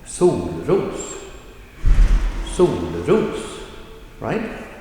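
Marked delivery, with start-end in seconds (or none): 0.1-3.4 "sol, ros" with a drop on sol and a lift on ros; falling, then rising. Shown as a demonstration. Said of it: "a falling tone".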